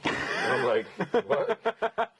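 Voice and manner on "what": high startled squeak of a what; a sudden high-pitched what. Retold or imitated. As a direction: jump up in pitch, caught off guard. Caught off guard by the lawyer.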